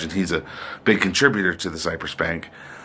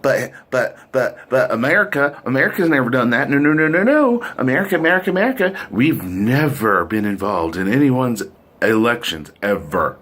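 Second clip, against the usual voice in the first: high-pitched, stammering mock protest; exaggerated flustered patriot in denial; mock indignant denial; 3 semitones higher than usual. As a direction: sputter in hammed-up disbelief, voice climbing. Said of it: "we have to sit there and be like".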